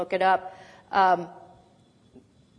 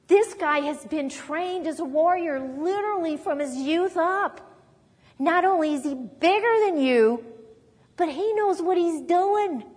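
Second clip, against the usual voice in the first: higher-pitched, swooping acted warning; incredulous King Saul; 6 semitones higher than usual. King Saul warning David about the giant warrior, incredulous. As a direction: lecture the boy in exaggerated disbelief. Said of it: "Saul takes one look at him and says".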